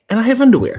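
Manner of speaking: high, loud sudden announcement; loud reveal. Acted.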